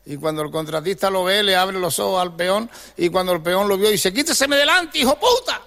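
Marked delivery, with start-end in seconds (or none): 4.1-5.6 angry insult. Acted. Herido insulta al contratista.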